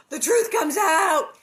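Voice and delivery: slow, loud proclamation; hammed-up gossip shout; dramatic shout. Real, not acted. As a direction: announce the reveal to the rafters. Reader reacts to the revelation.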